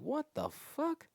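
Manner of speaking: high, slow, stretched disbelief; shocked outburst. Retold or imitated. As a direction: squeal it out in disbelief. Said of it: "I'm like".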